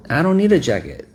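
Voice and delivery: put-on high, pouting child refusing; whiny kid.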